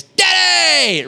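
put-on high, loud excited child's cry; kids shouting Daddy. Kids greeting dad at the door, excited high shout. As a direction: yell it like a kid thrilled dad's home.

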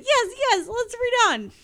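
very high, loud burst; gleeful excitement. Real, not acted. Reader delighted by the gun detail, gleeful excitement. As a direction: egg yourself on with loud glee. Gleeful aside while reading.

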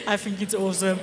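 amused, laughing at yourself. Real, not acted. Speaker apologising to the congregation for the accent, laughing. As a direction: talk through your own giggles.

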